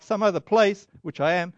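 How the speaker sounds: ordinary talk, nothing marked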